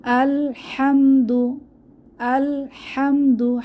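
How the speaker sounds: slow, drawn-out chanted recitation; slow melodic tajweed reading; Quran recitation. Shown as a demonstration. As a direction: recite it slowly with each vowel held. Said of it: "চলুন আমাদের প্রথম আয়াত আমরা দেখি".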